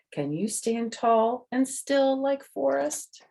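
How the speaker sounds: bright storybook reading voice; reading to children. Acted. The author reads her book to the kids, bright storytelling. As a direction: read it out to little listeners.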